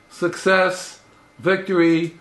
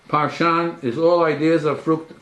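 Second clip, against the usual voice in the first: normal talking voice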